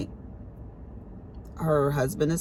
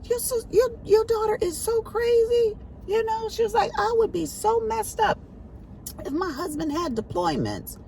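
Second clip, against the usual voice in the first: imitating sister. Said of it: "my sister used to say".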